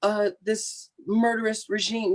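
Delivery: high, thin put-on voice, gushing thanks; mock grateful Iraqi voice. Speaker mimics Iraqi protesters thanking the US, high mock voice. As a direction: play up the gushing gratitude, pitched well up. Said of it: "And they were".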